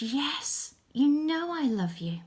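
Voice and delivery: high, emphatic storybook reply; earnest Peter. Acted. Disciple answering Jesus's question about love, earnestly emphatic. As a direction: insist on it, leaning on the first word. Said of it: "And Peter said".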